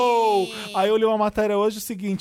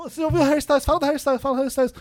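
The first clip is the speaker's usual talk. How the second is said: higher, eager fan gushing; excited fan voice; 6 semitones higher, faster than usual. Retold or imitated. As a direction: gush like an overexcited fan. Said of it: "eu nunca vi um disco sendo tão".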